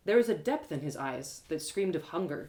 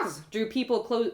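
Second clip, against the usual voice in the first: ordinary delivery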